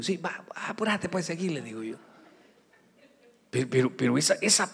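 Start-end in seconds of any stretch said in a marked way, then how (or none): 0.0-1.5 higher, softer hurried brush-off; mock impatient youth; impatient young man. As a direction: rush the pastor along. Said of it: "Pero estás arrepentido".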